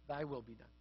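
low, hushed, resigned pronouncement; God's final concession. Retold or imitated. God answers people in the end, hushed concession. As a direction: hand it back softly and low. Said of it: "to whom God says in the end".

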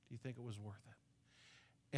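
a near-whispered, weighty echo of the question; quiet repeat. Shown as a demonstration. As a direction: let the question sink in, hushed and slow.

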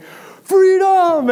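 high, loud yelled battle cry. Retold or imitated. Little brother defying his sibling, yelling high and loud. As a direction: belt it out like a rebel. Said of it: "he did the same thing".

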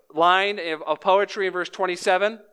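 normal talking voice

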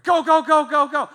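loud urgent go-go chant; rally the pushers loudly; shouting. Retold or imitated. Pushing car at green light.

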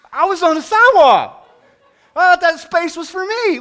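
high, loud exasperated protest; indignant outburst. Retold or imitated. To the dog owner, protesting loudly he was on the sidewalk. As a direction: throw it back at her, outraged.

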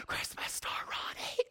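whispered, breathless with mock awe; hushed starstruck whisper; gasping whisper. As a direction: gasp and whisper it like a sacred secret. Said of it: "everybody was".

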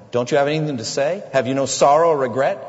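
loud, fast interrogating bark; police demand. Acted. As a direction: demand it like a raiding officer. Police raiding, demanding an answer.

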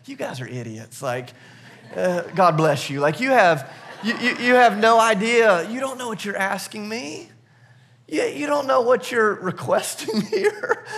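chuckling, amused and fond; laughing gently, half in disbelief; laughing Jesus. Retold or imitated. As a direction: laugh through the words at their naivety. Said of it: "I imagine him almost just laughing here".

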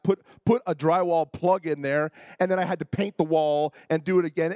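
ordinary talk, nothing marked

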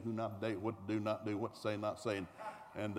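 ordinary talk

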